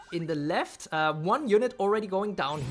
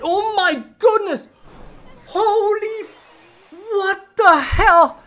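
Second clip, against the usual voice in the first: shrieking high and loud in shock; real jump-scare yell, laughing; startled shout; 12 semitones higher, 13 dB louder, much slower than usual. Real, not acted. Sudden loud blast startles streamer.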